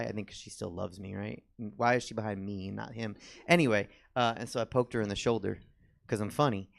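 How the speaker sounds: usual speaking voice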